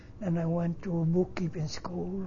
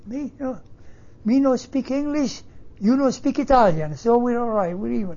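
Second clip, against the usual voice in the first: retold angry outburst, loud and high; angry mother; 6 semitones higher, 8 dB louder than usual. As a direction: snap back loudly as a mother who got mad. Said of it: "my mother got mad at her".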